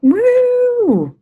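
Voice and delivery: a bright, loud, high whoop; excited woo. Real, not acted. New presenter cheering as she takes over the call, excited whoop. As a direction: cheer to kick off the talk.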